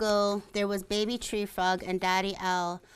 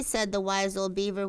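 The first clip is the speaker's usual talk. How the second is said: plain reading voice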